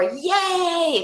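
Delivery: a high, bright yay; playful celebration; cheering yay. Shown as a demonstration. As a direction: praise the child's success. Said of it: "if you do something great".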